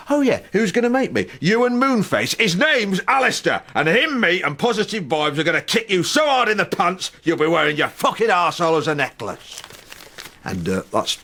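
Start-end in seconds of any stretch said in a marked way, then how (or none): 0.1-9.6 high, loud mock threat; hammed-up pub-brawl threat; threatening shout. Threatening the anger.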